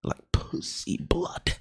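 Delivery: hushed whisper. Real, not acted. Dramatic reveal of stained floor.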